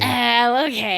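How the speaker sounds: loud, giddy agreement; mock eager. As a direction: jump at the offer. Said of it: "she's just like".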